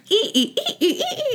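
imitated marker squeaks, very high; high squeaky "ee, ee, ee". Randy scribbles on the screen, imitated as squeaks. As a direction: squeak out short repeated high bursts.

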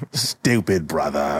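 low, slow grumble; bitter old Pork. Acted. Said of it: "he's old and bitter".

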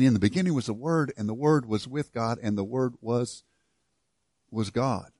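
soft, reverent recital of the verse; hushed scripture reading. Acted. Pastor quietly reciting John's opening verse to the congregation, hushed and reverent. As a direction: let the verse settle quietly on the room.

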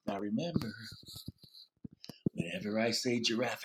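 soft, low teacher reminder; quiet reminder. Shown as a demonstration. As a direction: drop to a hush for the class. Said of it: "everybody's quiet".

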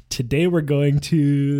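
Miss Frizzle. Acted. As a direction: announce it like an eager teacher. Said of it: "Miss Frizzle's just like".

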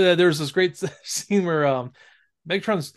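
usual speaking voice

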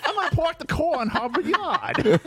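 high-pitched mock Boston accent; hammed-up Boston accent. Teasing a co-host's accent, hammed-up Boston accent. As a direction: ham up a Boston accent, laughing. Mocking the accent with stock phrase.

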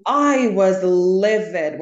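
slow, forceful stress on livid; emphatic LIVID. Real, not acted. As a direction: lean hard and slow on "livid". Stressing how angry they were.

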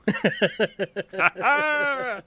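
high, loud laugh at his own joke; real laugh. Real, not acted. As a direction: burst out laughing.

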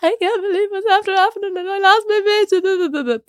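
high, hammed-up snotty crying wail; mock Blair Witch sobbing. Retelling her hacked-account panic call, mock sobbing high. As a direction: imitate her own panicked sob into the phone. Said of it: "Cried into the phone like Blair Witch".

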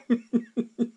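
high chuckle in short bursts; laugh. Real, not acted. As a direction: laugh at your own admission. Laughs at his own admission.

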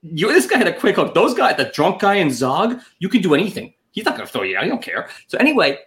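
usual speaking voice

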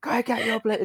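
angry farmer. Retold or imitated. Redoing the farmer's shout after being asked, hammed-up yell. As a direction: ham up the farmer bellowing at them. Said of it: "this was very loud".

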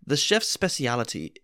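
hammed-up storyteller voice; playful theatrical narration; dramatic story reading. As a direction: read it like a grand dinner theater tale. Reads the Mad Lib story aloud.

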